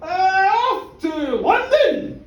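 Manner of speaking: stretched theatrical shout. Acted. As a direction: shout it like a magic spell. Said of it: "he shouted out".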